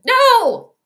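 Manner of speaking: high, loud cry of no; shout of dismay. Real, not acted. Streamer yells at the screen in dismay, loud shout. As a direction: yelp in real shock. Reacting to a bad play.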